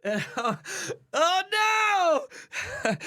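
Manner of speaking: high-pitched comic dismay; mock panic. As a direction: let the dread burst into a cartoonish cry. Mock panic at being recognized.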